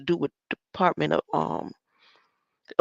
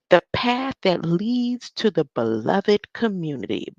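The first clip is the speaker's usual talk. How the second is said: ordinary talk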